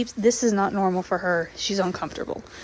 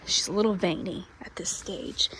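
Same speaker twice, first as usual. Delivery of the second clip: whispering; 3 semitones lower, 5 dB quieter than usual. Real, not acted. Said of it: "trying not to talk too loudly".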